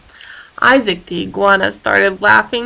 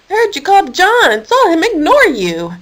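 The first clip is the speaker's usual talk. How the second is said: indignant; 8 semitones higher than usual. Acted. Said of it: "Keith said indignantly".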